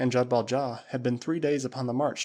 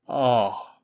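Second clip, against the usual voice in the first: booming Waziri warrior; 6 dB louder than usual. Acted. Usula answering his master Tarzan, loud and proud. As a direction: call it out like a loyal tribesman.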